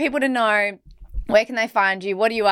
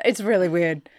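ordinary delivery

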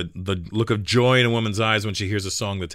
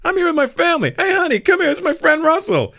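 very high, louder excited introduction; 4 dB louder than usual. Retold or imitated. Rock star calls wife over, retold high excited voice. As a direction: imitate eagerly calling over a spouse. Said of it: "He goes".

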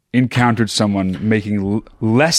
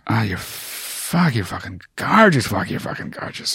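mock smitten film star, hushed; Colin Farrell; 7 dB quieter than usual. Joining in on star admiring the girlfriend, soft mock swoon. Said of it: "Colin Farrell was just like".

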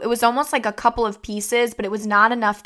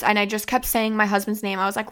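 ordinary delivery

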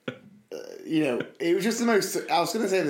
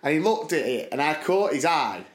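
ordinary talk, nothing marked

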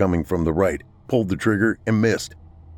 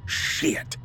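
hushed, bitter curse; spat curse; 6 dB quieter than usual. A narrator's reading. Man learns his dogs ran off, spat curse. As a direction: spit it out under the voice. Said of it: "Glenn spat".

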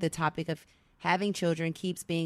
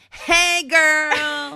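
put-on shouted high Hey, girl; excited greeting; 10 semitones higher, 11 dB louder than usual. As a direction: greet her loud and bright. Loud greeting to the letter writer.

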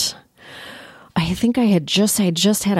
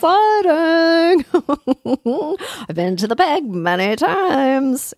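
mock excitement; 8 semitones higher than usual. Sarcastic about Winnipeg.

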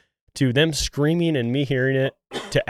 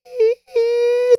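high squealing yelp; mock high-pitched squeal of a hunter; imitated squeal; 6 dB louder than usual. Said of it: "I hear Nick squealing".

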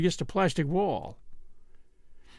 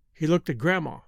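plain reading voice

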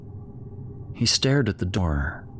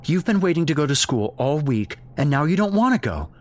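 exasperated, pitched up then dropping; scolding mother; 8 semitones higher, faster than usual. Acted. Mother berating her son about school, exasperated. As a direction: chide the child in disbelief.

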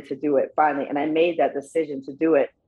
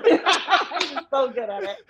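high, slowed by real laughter; laughing; 9 semitones higher, slower than usual. Real, not acted. Niece praises her uncle's promo skills, laughing. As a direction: say it through a fond laugh.

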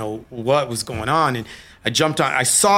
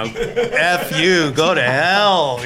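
raised, higher mock yell; retold angry brush-off; 5 semitones higher, 4 dB louder, much slower than usual. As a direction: imitate a furious send-off, stretching the last words. Ex-wife's angry reply, acted out.

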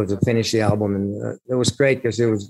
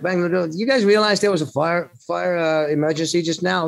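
exasperated; 7 semitones higher than usual. Retold or imitated. Confronting partying bandmates about fire.

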